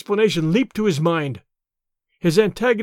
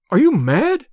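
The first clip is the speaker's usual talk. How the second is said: higher-pitched incredulous question; 5 semitones higher, 4 dB louder than usual. A narrator's reading. Decaud to Tarzan who wants to be shot, incredulous. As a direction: ask in disbelief, pitch swooping upward. Astonished duelist offered his opponent's pistol.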